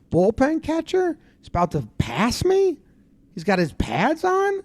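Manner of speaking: high, disbelieving mock questions; incredulous. Player seeing the bullpen catcher pass, high and incredulous. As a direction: imitate a player appalled at being overtaken.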